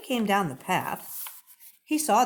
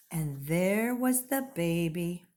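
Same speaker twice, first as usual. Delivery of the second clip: usual speaking voice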